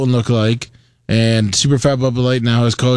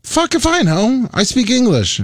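mock indignant; 11 semitones higher than usual. Real, not acted. Joking dismissive reply.

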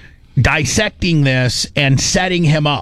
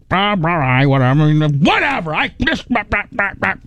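high, dismissive mock babble; hammed-up petulant yammering; mock ranting; 3 semitones higher than usual. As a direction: parody your own tantrum in nonsense. Mimics own ranting reply.

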